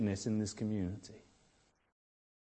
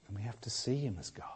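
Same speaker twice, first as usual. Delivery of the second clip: fast, soft whispered urging; reverent whisper; 4 semitones lower, 13 dB quieter, faster than usual. Acted. Preacher urging the church to see God, whispering. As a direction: whisper it like a confession.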